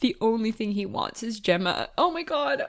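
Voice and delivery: overcome, choking up by the end; tearing up. Real, not acted. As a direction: voice rising as tears return. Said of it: "I'm actually tearing up right now".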